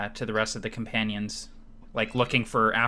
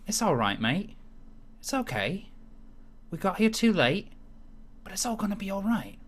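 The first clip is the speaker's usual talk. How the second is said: higher, soft reassuring character voice; a higher, gentle Pebble soothing a grieving friend; Pebble's voice; 9 semitones higher, 4 dB quieter than usual. Acted. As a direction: comfort him gently in Pebble's voice. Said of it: "you hear Pebble say".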